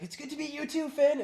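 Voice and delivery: put-on lifted way up, eager and boyish; high eager Finn impression. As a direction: squeak out the greeting like an excited kid.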